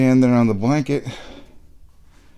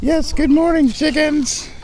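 much higher, sing-song greeting to the hens; high cooing pet voice; 5 dB louder than usual. Real, not acted. Farmer greets his hens in a high cooing voice. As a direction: coo at the chickens like babies.

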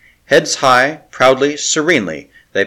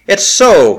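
leaps up high and loud with hope; sudden hope; 9 semitones higher, 6 dB louder than usual. A narrator's reading. Larry catches new hope from his friend, loud and high. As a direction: burst out as hope returns.

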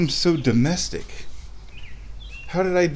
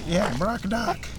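high pet voice; 7 semitones higher, 4 dB quieter, slower than usual. Real, not acted. Talking to chicken.

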